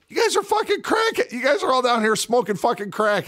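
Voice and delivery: high-pitched mocking disbelief; retold ridicule. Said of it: "I ridiculed them".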